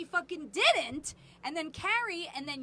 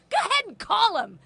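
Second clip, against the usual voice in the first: higher, louder daring challenge; mother daring the teen to call cops; defiant dare; 6 semitones higher, 9 dB louder than usual. Retold or imitated. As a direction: dare her to do it. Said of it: "And I go".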